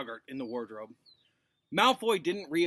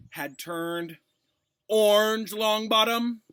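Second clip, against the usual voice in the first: higher, much louder, disdainful; contemptuous sneer; 7 semitones higher, 9 dB louder, slower than usual. Acted. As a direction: sneer at the boy's failure. Snape disgusted at ruined potion.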